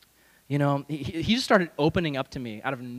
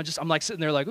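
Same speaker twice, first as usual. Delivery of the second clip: normal talking voice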